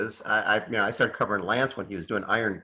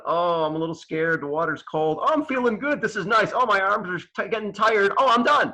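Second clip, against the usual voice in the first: higher and higher, mock inner monologue; inner voice while swimming; 9 semitones higher than usual. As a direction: voice your own thoughts in the cold water, climbing up. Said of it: "you're going".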